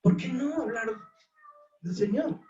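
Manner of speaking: high, pressing preacher's challenge; fervent preaching. Real, not acted. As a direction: demand an answer from the flock. Preaching climax, high pitch. (reto fervoroso de predicador)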